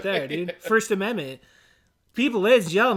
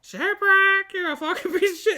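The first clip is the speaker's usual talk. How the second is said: high parrot screech; high-pitched cartoon parrot insult; Polly; 11 semitones higher than usual. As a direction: squawk it as an abusive bird. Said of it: "thing that Polly would say".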